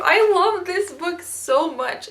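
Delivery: high, slow gushing squeal. Real, not acted. Fan gushing to viewers about favourite book, squealing. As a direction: swoon over the book.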